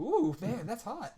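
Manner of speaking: high, soft breathy-fan "ooh, man, that's hot"; mock swoon. Host teasing cohost about the hot voice, soft high mock swoon. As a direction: copy a fan melting over a voice, lightly. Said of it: "You kind of had a little mini freak out".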